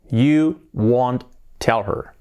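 very slow, separated words; slow model sentence. Shown as a demonstration. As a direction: dictate it word by word to learners. Teacher models sentence slowly, then faster. (frase modelo, palabra por palabra)

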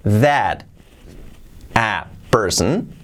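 "That a person" slowly, rising on "person"; slow model phrase. Shown as a demonstration. Teacher slows the phrase so learners hear it. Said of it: "Fijaos que no digo is".

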